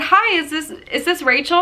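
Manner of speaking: cheery caller imitation. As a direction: imitate a caller checking who answered. Voices caller in a retold phone call.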